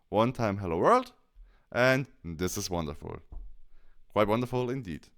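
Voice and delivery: normal talking voice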